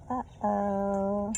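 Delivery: ordinary delivery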